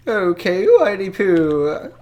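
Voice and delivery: slow, high, loud mystic drawl; hammed-up fortune teller crooning.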